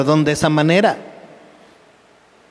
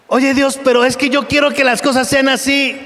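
high, sugary mock voice of a spoiled believer; sing-song high whine, acting spoiled; whiny pampered believer; 9 semitones higher than usual. Preacher mocking a comfort-seeking believer, high whiny voice.

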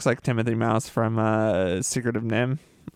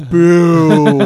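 booing; 4 semitones higher, 10 dB louder than usual. Real, not acted. Booing the boring villains.

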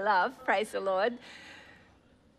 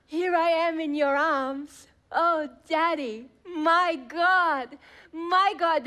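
tender prayer; 4 semitones higher, 4 dB louder, slower than usual. Acted. Acted tender prayer to God.

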